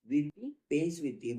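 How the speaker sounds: drawn out word by word; slow rubric dictation. Shown as a demonstration. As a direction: read it out for students to copy. Teacher repeats the rubric name.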